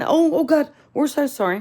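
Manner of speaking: high, gushing staff apology; mimicking the staff begging her to come back, pitched high; mock apology. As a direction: ham up the store's sorry pleading. Staff apologizing after walkout.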